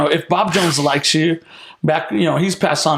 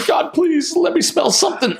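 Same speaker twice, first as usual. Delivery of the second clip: high mock-terrified prayer; hammed-up begging, much higher pitch; desperate plea; 11 semitones higher than usual. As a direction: plead urgently in a put-on high voice. Acts his terrified plea to God.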